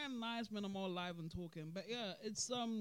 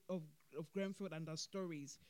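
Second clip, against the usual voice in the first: ordinary talk, nothing marked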